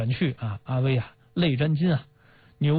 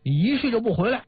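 scolding; 9 semitones higher than usual. Retold or imitated. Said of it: "这个副将军大骂说".

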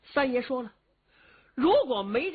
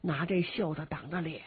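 ordinary delivery, nothing marked

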